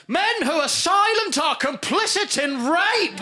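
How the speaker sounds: slow, high-pitched shouted accusation; accusing shout. Retold or imitated. Comic reads out the next tweet accusing silent men, shouting. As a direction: bellow it out like a damning charge.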